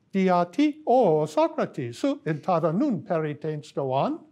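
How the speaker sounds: Greek chanted with rising and falling pitch accents, sung-like; reconstructed tonal Greek. Shown as a demonstration. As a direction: recite "Dia ti, o Socrates" with melodic pitch accents like a chant. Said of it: "on their system would have gone something like this".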